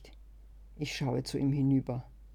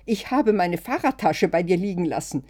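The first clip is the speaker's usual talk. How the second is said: loud, higher panicked shout; 5 semitones higher, 9 dB louder than usual. A narrator's reading. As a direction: yell it out in alarm. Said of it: "schrei ich". (laut und panisch geschrien)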